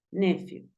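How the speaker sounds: "nephew" said NEF-yu; short E in the first syllable of "nephew". Shown as a demonstration. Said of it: "A veces lo he escuchado como".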